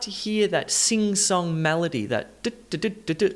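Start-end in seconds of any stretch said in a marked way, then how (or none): 2.4-3.3 choppy du-du-du syllables; Morse code rhythm. Shown as a demonstration. Teacher imitates the melody, Morse code rhythm. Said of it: "that Morse code type".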